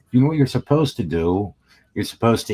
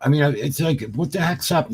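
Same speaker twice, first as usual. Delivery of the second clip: high mock question; 7 semitones higher than usual. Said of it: "No, I said".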